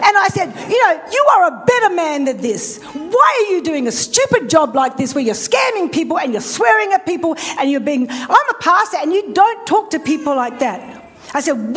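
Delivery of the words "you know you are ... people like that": emphatic retold motherly telling-off; scolding mother. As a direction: give it to them like a good mother. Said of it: "give it to him like a good mother would".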